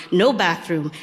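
plain reading voice

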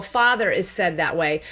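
normal talking voice

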